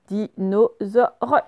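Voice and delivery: very slow, evenly separated syllables. Shown as a demonstration. Dicte des mots pour repérer z.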